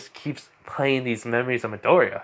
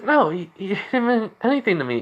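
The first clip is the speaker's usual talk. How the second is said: high-pitched, stammered denial; flustered denial; 9 semitones higher than usual. Retold or imitated. Crying girlfriend denies to boyfriend she loved another, flustered. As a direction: protest too much, voice pitched up.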